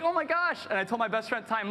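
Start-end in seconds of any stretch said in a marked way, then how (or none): 0.0-0.6 high, thrilled teen squeal of a retold moment; excited gasp. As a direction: squeal it like a kid who just saw the news. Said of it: "'cause I was like".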